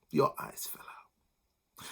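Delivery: slow scornful whisper; whispered disbelief. A narrator's reading.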